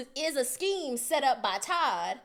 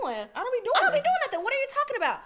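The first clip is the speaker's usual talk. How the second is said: much higher, loud disbelieving protest; outraged squeal; 13 semitones higher, 5 dB louder than usual. Retold or imitated. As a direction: squeal the denial in shock. Retold outraged reply to boyfriend.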